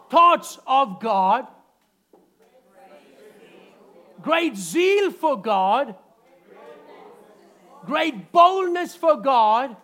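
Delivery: slow, loud proclamation; preaching with force. Acted. Preacher calling out the points to the congregation. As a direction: hammer each phrase home, slowly.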